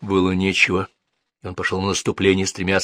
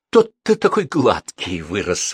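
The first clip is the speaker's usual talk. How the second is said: exclaiming; 2 semitones higher, 5 dB louder, slower than usual. A narrator's reading. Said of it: "воскликнул Максим".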